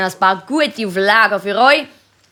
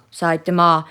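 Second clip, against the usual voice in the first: ordinary talk, nothing marked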